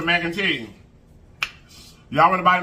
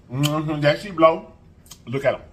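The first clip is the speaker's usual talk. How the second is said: ordinary delivery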